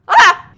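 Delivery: short, loud, high cry; sudden yelp. Real, not acted. Player calling gnome to help, sudden yelp. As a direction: let out a sharp "ah".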